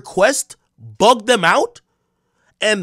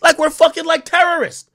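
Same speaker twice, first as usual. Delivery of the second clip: upset disbelief; 11 semitones higher, 4 dB louder than usual. Real, not acted. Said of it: "I'm so upset".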